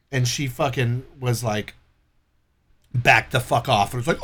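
ordinary talk

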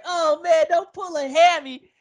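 high, drawn-out mock worry; high-pitched pleading to a runner; mock concern. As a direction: fret aloud in a high, slowed voice. Imagined reaction to a sprinting hero.

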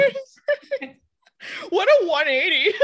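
high, loud, laughing until near tears; helpless cackling disbelief; laughing hard. Real, not acted. Cracking up at the stranger's sudden topic change.

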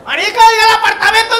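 high, loud words through a burst of laughter; cracking up mid-sentence; laughing out loud. Real, not acted. (riéndose a carcajadas, más agudo y fuerte)

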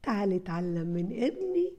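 usual speaking voice

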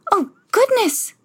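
high, slow startled cry; gasping Cinderella. Acted. Cinderella hears midnight strike, high and startled. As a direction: blurt it in sudden alarm. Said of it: "she gasped".

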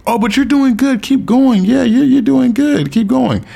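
very high, patronizing mock praise; demeaning encouragement. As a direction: imitate someone cooing condescending encouragement at a learner. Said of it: "That shit sounds so demeaning".

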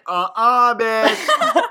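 high, loud sassy brush-off with a laugh; sassy rejection. Retold or imitated. Katara rejecting Jet, high loud sass. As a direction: shut him down with sass. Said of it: "And she's like".

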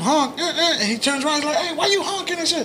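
high, giggly telling of the honk; laughing while retelling. Retold or imitated. Friend laughing while retelling the honk scene. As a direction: laugh through the story.

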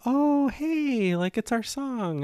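high, drawn-out delighted noticing; imitating a partner spotting their song; pleasantly surprised. Retold partner hearing their song.

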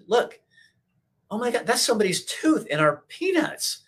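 high shocked disbelief; retelling a gross discovery. Retold or imitated. Retold shock at tooth in peanuts.

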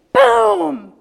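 loud imitated door bang; loud door slam boom. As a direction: slam the sound out.

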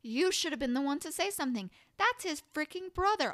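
loud, high, fast indignant rant; angry outburst. Real, not acted. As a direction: yell at the character in outrage. Ranting at the character.

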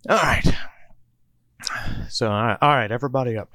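loud, high rousing call to get up; waking the group with a loud call; loud wake-up call. Acted. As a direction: bark everyone awake. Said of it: "says loudly, awakening the group".

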